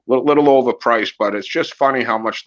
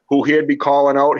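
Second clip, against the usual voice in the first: usual speaking voice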